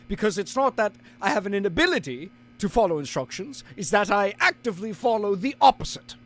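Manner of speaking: indignant British narrator, hammed up; British accent. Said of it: "Be your British self".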